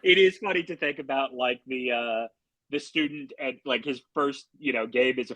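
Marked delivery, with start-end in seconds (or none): none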